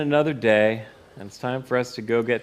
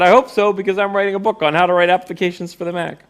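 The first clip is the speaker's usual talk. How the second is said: louder, higher, half-joking protest; higher and louder, insisting with a wink; defensive outburst; 8 semitones higher, 6 dB louder than usual. Retold or imitated. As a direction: defend the project with mock indignation. Defensive reply to girlfriend.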